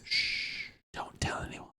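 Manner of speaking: hushed unvoiced shh, secretive; whisper. Hiding beer from listener, whispered.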